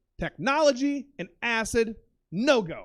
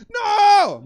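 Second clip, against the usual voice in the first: high, loud drawn-out cry; panicked yell; 11 semitones higher, 12 dB louder than usual. Retold or imitated. Passenger denying theft to Uber driver, panicked yell. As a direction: belt out a panicked denial.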